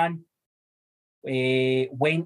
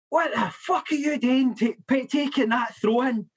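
mock irate manager; 7 semitones higher than usual. Manager turns round to berate player over throw-in, mock outrage. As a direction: imitate a manager's disbelieving rant, pitched high.

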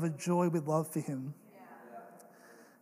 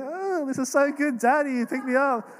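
put-on high, excited baby's voice; 8 semitones higher, 4 dB louder than usual. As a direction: voice the baby's delight. Baby's thoughts voiced by dad.

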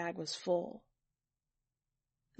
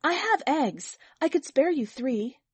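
higher, louder, keen to offer; eager offer, pitched up and pushed out; 6 semitones higher, 5 dB louder than usual. A narrator's reading. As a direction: neighbor eagerly offering eggs. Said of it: "Another woman said eagerly".

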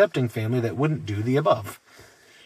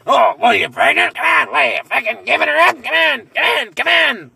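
high, loud jeering shout of come on; mocking pushy shout; 9 semitones higher, 7 dB louder than usual. Mocking a pushy wife, loud high jeering shout. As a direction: badger her like a pushy drunk relative. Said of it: "That's where it would end".

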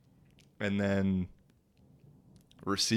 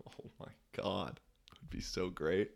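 giddy whisper; 2 semitones lower, 11 dB quieter than usual. Real, not acted. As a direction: whisper in delighted disbelief. Imagining watching himself on TV.

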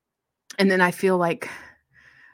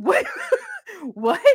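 bemused son. Retold or imitated. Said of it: "He said".

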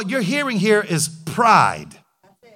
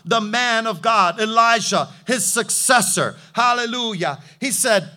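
usual speaking voice

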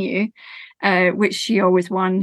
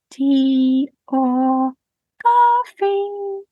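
lilting rise, much higher on coffee; old woman offering a cuppa; cheery old woman; 4 semitones higher, much slower than usual. Acted. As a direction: offer it brightly with a sing-song lift.

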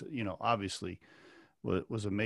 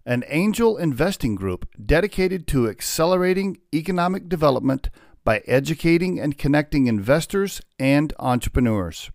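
louder, polished podcast ad read; sponsor ad read; 5 dB louder than usual. Acted. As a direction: read the sponsor spot like an announcer.

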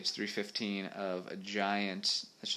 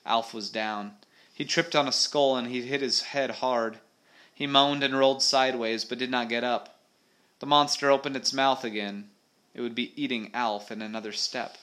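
animated adventure read-aloud; story narration; 4 semitones higher, 8 dB louder than usual. A narrator's reading. Reader telling of a monster fight, story narration.

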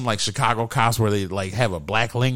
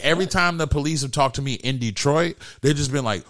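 ordinary talk

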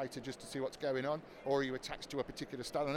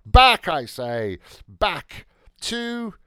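dramatic announcer; 12 dB louder, much slower than usual. Acted. Host's dramatic radio flourish.

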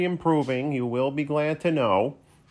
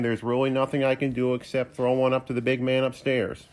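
ordinary talk, nothing marked

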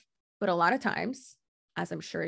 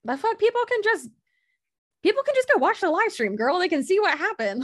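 much higher and louder, exasperated; outraged retort; 11 semitones higher, 5 dB louder than usual. Retold or imitated. Retorting to the accuser about the live stream, outraged and loud. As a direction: fire it back in disbelief. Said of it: "And I'm like".

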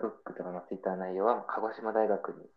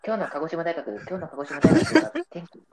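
higher, louder rushed news-style rundown; all in one breath; 7 semitones higher, 9 dB louder than usual. Shown as a demonstration. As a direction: rattle it off without a pause. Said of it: "三十秒で一息で喋るっていう".

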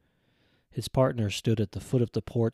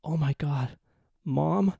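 higher, voice breaking into tears; boy reaches his lost mother, crying; 7 semitones higher, 3 dB louder, slower than usual. A narrator's reading. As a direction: let the sobs crack through. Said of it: "He was crying now".

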